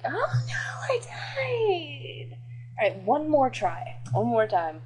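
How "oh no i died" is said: tiny high whispered mock cry. Mock panic as the dying character. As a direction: squeak it out as a pretend panicked kid.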